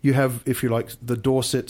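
ordinary delivery

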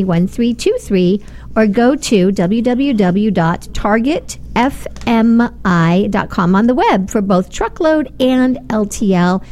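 ordinary talk, nothing marked

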